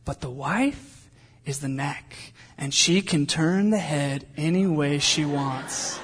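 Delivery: slow, deliberate movie quote; measured, drawn-out retelling of a line; lightly voiced movie line. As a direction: slowly quote a wise film mother, lightly done. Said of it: "she goes".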